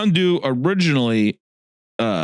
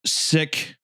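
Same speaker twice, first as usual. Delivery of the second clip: usual speaking voice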